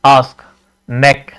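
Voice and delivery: आस्क and नेक said short, vowels not stretched; brief, separate आस्क and नेक. Shown as a demonstration. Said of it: "हम short पढ़ते हैं".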